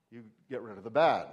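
long audible sigh out; demonstration exhale. Shown as a demonstration. Pastor showing the congregation an exhale, long sigh. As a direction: let the breath go with a sigh. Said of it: "when you exhale".

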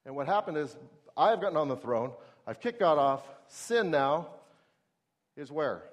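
ordinary talk, nothing marked

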